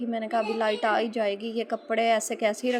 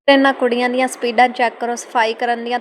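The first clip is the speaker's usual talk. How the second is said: louder, punchier take of the viral Punjabi line, stressing चेक; louder TikTok line; 9 dB louder than usual. Retold or imitated. Recalling the viral dialogue while cleaning, said louder. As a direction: belt out the catchphrase, hitting चेक. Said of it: "वो कहता है ना".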